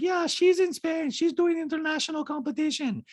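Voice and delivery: put-on very high-pitched accented caricature; squeaky falsetto mimicry of a reality star; Diana impression. Host mimics reality star in recap, high-pitched caricature. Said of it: "And Diana's like".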